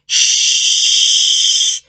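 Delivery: "shh" as a long hiss of air; airy shh. Shown as a demonstration. Teacher demonstrates the airy "shh". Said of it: "tons of air".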